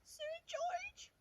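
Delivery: very high, thin whispered squeak; squeaky knight. Acted. Timid knight announcing himself to the dragon. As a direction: squeak it like a tiny scared knight. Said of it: "squeaked the thin, shiny knight".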